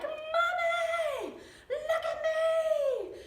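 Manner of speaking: put-on high, loud, drawn-out child's shout; excited little boy. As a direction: call out to mom, bursting with pride. Child calls proudly to mother.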